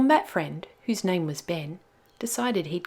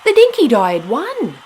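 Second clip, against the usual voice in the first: higher and much louder, cheering; excited climax; 7 semitones higher, 9 dB louder than usual. A narrator's reading. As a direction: shout the win like the crowd roaring. Climax of the boat race.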